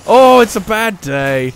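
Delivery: loud exasperated outburst; frustrated yelling. Real, not acted. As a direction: yell at the game in frustration. Frustrated gamer yelling at game.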